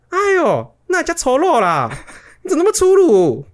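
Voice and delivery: very high squealing exclaims with a laugh; mock shocked exclaim. Coworkers gasp at the mom's rough stitch removal, very high mock shriek. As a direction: shriek in mock horror, drawn out. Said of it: "一直发出惊呼".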